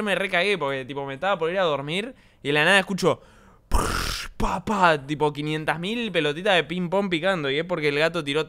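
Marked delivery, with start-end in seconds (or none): 3.7-5.0 bouncing ball noises. Retold or imitated. Imitating ping pong balls.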